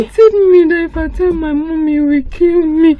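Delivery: put-on girl panicking in tears to her friend; crying rush. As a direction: gush it out through tears. Girl breaks down.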